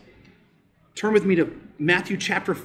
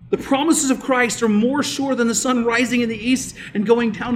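full-force preaching; 7 semitones higher, 6 dB louder than usual. Acted. Pastor preaching to the church, full force. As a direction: preach it out to the congregation with rising power.

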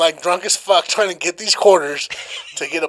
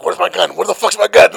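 panicked search; 7 dB louder than usual. Retold or imitated. Panicking as police knock.

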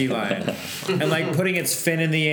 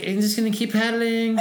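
high, laughing, breezy voice; laughing impression of her; 10 semitones higher than usual. Paddleboarder after the sea lion charge, high and laughing. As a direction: play her as oblivious, pitched up through a laugh. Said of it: "she was just like".

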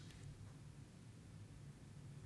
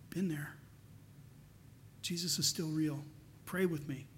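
gentle comfort; 3 semitones lower, 8 dB quieter than usual. Retold or imitated. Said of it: "you look at 'em and you go".

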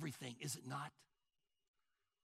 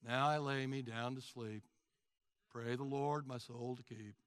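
low, soft monotone bedtime prayer; no emotion; 9 semitones lower, 5 dB quieter than usual. Acted. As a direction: drone it out, tailing off toward bed. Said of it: "There's no emotion".

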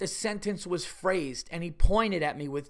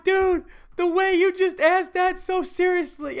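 laughing hard; 12 semitones higher, 7 dB louder than usual. Retold or imitated. Both crying with laughter.

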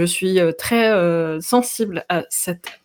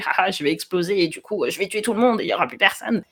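lightly voiced gloating cartoon villain; quick, gleeful little evil voice; faster than usual. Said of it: "qui a son petit rire démoniaque et qui dit".

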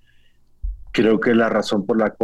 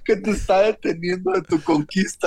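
mock preacher; 9 semitones higher than usual. Mock preaching while laughing.

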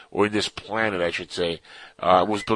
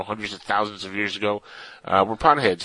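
ordinary delivery

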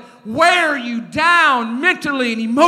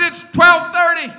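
loud, raised, exasperated announcement; exasperated father shouting the hour; shouting dad; 5 semitones higher, 7 dB louder than usual. Retold or imitated. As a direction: yell the time at a son still awake. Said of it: "I said".

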